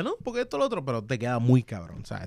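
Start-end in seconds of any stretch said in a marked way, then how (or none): 0.0-0.8 higher, softer imitation of grumbling; mock complaining. Host imitating how the guest complains, mock grumbling. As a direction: imitate a fussy complaint, pitched up. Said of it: "Tú te quejas".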